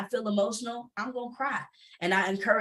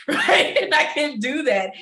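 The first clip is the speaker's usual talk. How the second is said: higher, louder, laughing through it; real laughing; 7 semitones higher, 8 dB louder, slower than usual. Real, not acted. Panelist sharing with fellow entrepreneurs, laughing. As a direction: laugh into the words. Laughs while making her point.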